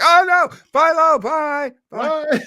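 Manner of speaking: loud, high wailing farewell; dramatic goodbye. Retold or imitated. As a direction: cry out bye in mock grief. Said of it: "And it was like".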